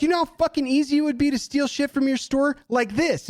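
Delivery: high-pitched angry taunt; losing it. Retold or imitated. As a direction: throw it at them, pitch way up. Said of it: "I lost my fucking mind".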